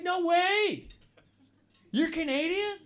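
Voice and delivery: high-pitched, gushing disbelief; amazed American girl. Acted. American girl on hearing he is Canadian, amazed. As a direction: imitate an astonished, naive fan.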